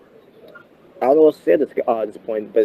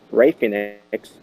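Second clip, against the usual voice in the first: usual speaking voice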